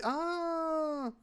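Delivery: high startled cry; a sharp squeak of surprise; surprised yelp. Real, not acted. Sudden discovery while building.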